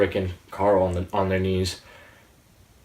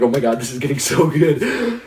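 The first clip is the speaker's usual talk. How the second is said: higher, louder gush of excitement; excited reaction; 6 semitones higher, 6 dB louder than usual. Retold or imitated. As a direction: relive being thrilled by the episode. Retelling own excited viewing reaction.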